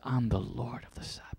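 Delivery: soft, unvoiced reverent whisper; whispered quote. Preacher quoting Christ's words to the congregation, a hushed whisper. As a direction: breathe the title quietly.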